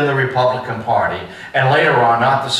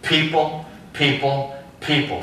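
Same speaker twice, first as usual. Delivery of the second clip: "people" repeated higher, each one set apart; gently raised, separate beats on "people"; stress on people; 3 semitones higher, 4 dB quieter than usual. Shown as a demonstration. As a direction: lift "people" as the key word, without punching it. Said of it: "he softened the preposition".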